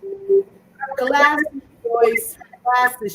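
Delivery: high, loud amused call; excited exclaim. Real, not acted. Laughing about mom's missing glasses.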